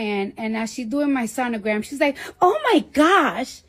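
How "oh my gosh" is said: high, slow put-on Staten Island girl voice; mock the doctor's Staten Island girl voice.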